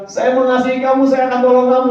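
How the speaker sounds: mock the friend who only talks; mock friend's empty promise. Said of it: "Rian cuma bilang".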